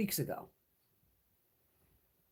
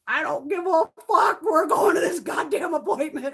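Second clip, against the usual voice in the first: loud, higher, slow indignant yelling; mock furious shout; 6 semitones higher, 6 dB louder than usual. Imitating mom shouting she won't miss her vaccine appointment, loud and slow. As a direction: belt it out like a fed-up mother refusing to back down. Mother swearing about missing appointment.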